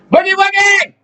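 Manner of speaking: gleeful hype shout; excited cry. Real, not acted. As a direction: belt it out to cue the song. Hyping up the song.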